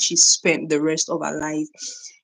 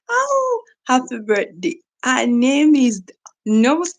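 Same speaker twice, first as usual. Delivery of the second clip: excited birthday wish; 7 semitones higher, 5 dB louder, slower than usual. Real, not acted. Viewer mentions mom's birthday.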